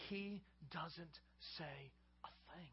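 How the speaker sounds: slow breathy whisper. Acted. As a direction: drop to a hushed whisper to paint the silence. Stressing Daniel's silence.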